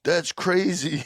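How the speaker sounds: high, drawn-out mock teen amazement; amazed friends. Kid's friends reacting to his news, mock amazed. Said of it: "his friends would be like".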